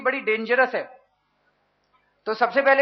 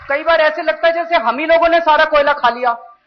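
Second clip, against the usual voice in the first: raised, louder ironic protest; higher and louder, incredulous at the blame; exasperated sarcasm; 5 semitones higher, 7 dB louder than usual. Real, not acted. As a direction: push it up in mock self-blame. Sarcastic complaint about media blame.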